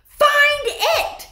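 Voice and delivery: very high, loud cackling scream; witch scream. Acted. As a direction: scream the order. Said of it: "screamed the Grand High Witch".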